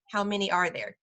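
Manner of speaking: ordinary delivery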